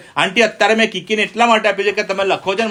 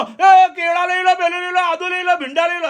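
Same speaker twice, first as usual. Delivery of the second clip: much higher, booming hawker cry; street vendor shout; 12 semitones higher than usual. Retold or imitated. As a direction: call out your vegetables across the lane like a street vendor. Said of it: "અરે એટલું જોરથી બોલે". (ફેરિયાની જેમ ઊંચા સૂરે જોરથી બૂમ)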